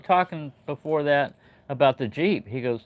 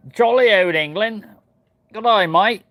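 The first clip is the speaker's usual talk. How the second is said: hammed-up jolly English accent, higher and louder; mock British accent; 8 semitones higher, 8 dB louder than usual. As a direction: play a cartoon Englishman. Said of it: "Oh, mate, it's Australia".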